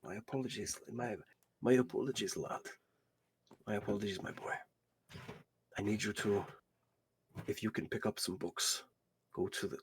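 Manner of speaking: put-on soft-spoken old grandfather, halting; apologetic old man. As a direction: apologise quietly, then give the errand gently. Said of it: "looks at you and goes".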